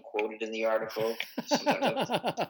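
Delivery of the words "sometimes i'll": laughing. Real, not acted. Laughing while describing his voices.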